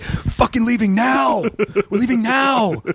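high, slow, half-laughing panic; panicked driver. Retold or imitated. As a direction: imitate the driver losing it. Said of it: "he was completely freaking out".

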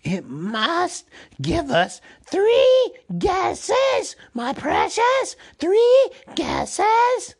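very high, loud, slow creature screech; Gollum's hissing demand. A narrator's reading. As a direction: screech the demand, drawing out each word. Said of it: "hissed Gollum".

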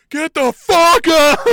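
huge high-pitched, drawn-out scream; Billy Gunn screaming. Retold or imitated. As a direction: imitate a frantic ringside yell at full volume. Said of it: "is screaming".

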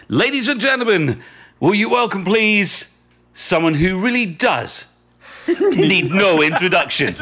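high, loud showman's welcome to the crowd; stage host announcement. Retold or imitated. Host retelling his onstage welcome to a star, grand announcer voice. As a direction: announce it like a compère on a London stage. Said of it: "I said the classic".

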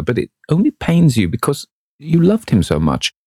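put-on high, bright-eyed young woman in love; a girl gushing about her sweetheart, pitched high; rapturous young girl. A narrator's reading. Sybil voiced in the story.

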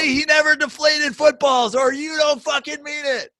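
high-pitched hammed-up rant; over-the-top insistent parody; mock fanatical demand. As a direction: press the demand like a crazed superfan. Mocking fans' escalating absurd demands.

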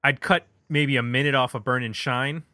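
ordinary talk

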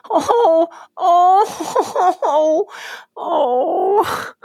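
high, loud, choked-up oh's; real tears welling up as she reacts; overcome with emotion. Real, not acted. Moved by the crying dreams story.

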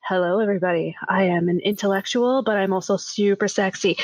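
low, husky seductive purr; sexy deep voice. Retold or imitated. Mimicking how she hears her own voice, low and sultry. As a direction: play the sultry intellectual she imagines she sounds like. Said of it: "this is how I hear myself in my head".

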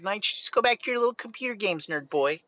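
high, fast, sneering wife; mocking wife voice. Wife mocking her husband to his face, high and fast. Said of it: "she was like".

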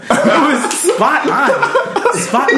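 laughing fake praise; slow, high, mocking praise; sarcastic. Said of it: "Are you being sarcastic?".

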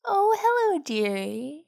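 high, bright-voiced old woman greeting; cheerful old woman. A narrator's reading. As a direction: welcome the guest like a kindly granny. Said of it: "greeted by a cheerful old woman".

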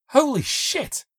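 sharp high burst of shock; shocked exclamation. A narrator's reading. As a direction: blurt it out in disbelief. Shocked reaction to news.

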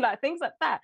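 ordinary talk, nothing marked

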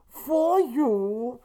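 high-pitched masked villain impression; Bane. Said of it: "Bane finishes his line".

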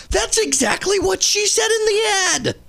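high-pitched, disbelieving comeback; exasperated retort. Real, not acted. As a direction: throw "That's exactly what she said in the ad" back at the commenter in disbelief. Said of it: "exasperated retort to a commenter".

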